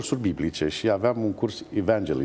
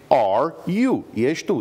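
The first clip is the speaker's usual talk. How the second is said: punchline; 6 semitones higher, 5 dB louder, slower than usual. Retold or imitated. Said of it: "Și toți începeau să râdă".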